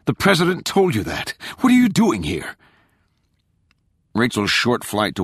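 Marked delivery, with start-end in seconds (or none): 0.1-2.6 high, agitated demand; heated questioning; angry challenge. A narrator's reading. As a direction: challenge the intruder, pitch raised. Father angrily challenges his daughter.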